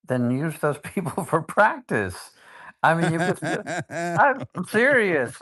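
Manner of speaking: laughing, pitch climbing high; amused, half-joking insistence. Real, not acted. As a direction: push the point through a laugh. Laughing while urging, pitch jumps.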